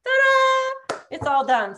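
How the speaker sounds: very high, loud hammed-up Julia Child flourish; a showy TV-chef ta-da that sweeps back down. Cooking teacher unveils the finished dish as Julia Child, high and showy. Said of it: "I'm gonna be like Julia Childs".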